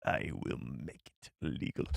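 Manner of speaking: low, slow whispered alien menace; Nute Gunray voice. Pretend. Streamer copying the clip for a viewer, low slow whisper. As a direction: breathe it low and slow like a scheming viceroy. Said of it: "Let's hear your best Newt Gunray".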